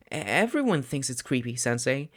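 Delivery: ordinary delivery, nothing marked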